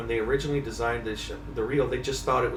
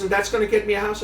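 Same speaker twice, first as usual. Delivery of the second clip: higher, quick incredulous question; mock wife doubting the plan, pitched up; skeptical wife; 9 semitones higher, 4 dB louder, faster than usual. Wife questioning her husband's reel logic, higher and quicker. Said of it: "And she goes".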